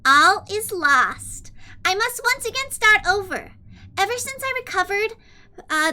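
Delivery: put-on high cutesy anime-girl read; super kawaii desu voice. Streamer reading a villain's note to chat in kawaii voice. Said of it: "a super kawaii desu voice".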